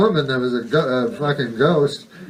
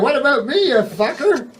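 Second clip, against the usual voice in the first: much higher, laughing protest; indignant outburst; 11 semitones higher than usual. Retold or imitated. Man yelling at the ghost, high laughing outrage. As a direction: squeal it, half laughing, offended. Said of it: "and then you're like".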